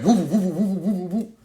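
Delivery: high looping woops; rapid vocal siren whoops; imitated police siren. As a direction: wobble fast like a squad car siren.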